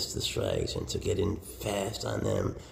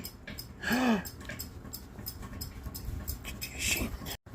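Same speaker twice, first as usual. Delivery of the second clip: big breathy pretend gasp; mock gasp; 4 dB quieter than usual. Owner fakes shock at her snake, a hammed-up gasp.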